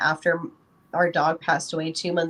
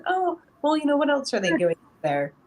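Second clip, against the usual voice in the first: mock surprise to son; 9 semitones higher than usual. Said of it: "And I said".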